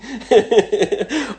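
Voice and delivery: loud high-pitched laugh; cheeky loud laugh. Real, not acted. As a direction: burst out laughing. Flirting with blonde trainer.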